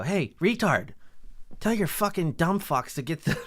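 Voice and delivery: rude insult. Retold or imitated. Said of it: "Don't come out and go".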